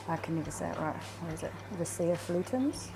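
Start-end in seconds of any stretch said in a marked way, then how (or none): none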